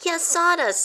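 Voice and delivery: high, subdued little voice; weak. A narrator's reading. A guilty mouse admits it weakly, high. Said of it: "said Christopher weakly".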